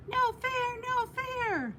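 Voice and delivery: very high, piercing bird cry. A narrator's reading. Honey Guide crying out to the whole savanna, very high. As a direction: cry it out so all the animals hear. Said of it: "she cried out for all the animals to hear".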